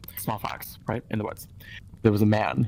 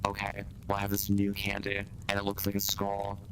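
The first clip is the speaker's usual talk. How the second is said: soft witch voice; 3 semitones lower, 7 dB quieter than usual. Acted.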